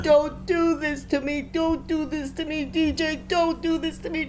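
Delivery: grief-stricken, reliving the plea; real anguished crying. Real, not acted. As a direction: plead through real tears. Mother relives finding son dead.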